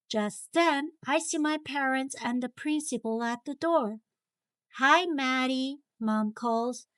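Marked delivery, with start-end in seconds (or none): none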